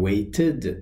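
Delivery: "waited" split into wait-id; ED as extra syllable. Shown as a demonstration. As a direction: sound a clear second syllable in "waited". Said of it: "ای دی صدای".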